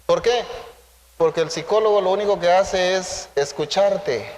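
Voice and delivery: usual speaking voice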